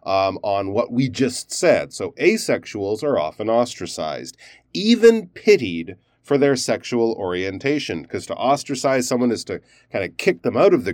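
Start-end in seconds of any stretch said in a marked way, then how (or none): 1.9-8.0 even pitied lifted and set off by pauses; pause, stress even pitied, pause, then carry on; stressed aside with pauses. Shown as a demonstration. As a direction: make even pitied its own emphasized bracket. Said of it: "stress the word pity and maybe even have a pause".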